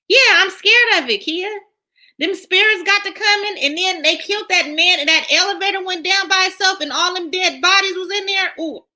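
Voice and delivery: high, animated retelling of the scary scenes; hammed-up spooked. Telling Kia what scared her in the show, mock spooked and high.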